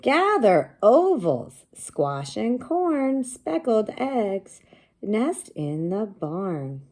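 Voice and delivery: high, loud, slow storybook read-aloud; sing-song read-aloud for young kids; reading to children. Acted. Teacher reading the book aloud to her class, slow and bright.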